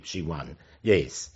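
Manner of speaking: normal talking voice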